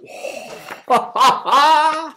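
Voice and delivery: sudden high delighted laugh; burst of high laughter. Real, not acted. Vlogger laughing at the surprise poster, high laugh.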